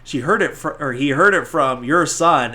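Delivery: lightly indignant comeback; mock offended. Ralphie's mom blames the other son, mock offended. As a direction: push back as an affronted mother. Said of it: "gets all offended".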